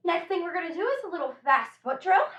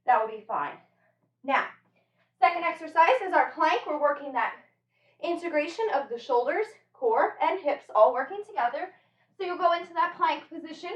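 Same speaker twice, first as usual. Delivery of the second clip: ordinary talk, nothing marked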